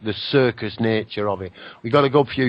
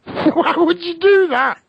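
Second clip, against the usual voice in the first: laughing disbelief; 4 dB louder, slower than usual. Real, not acted. Mocking reptilian theory, laughing.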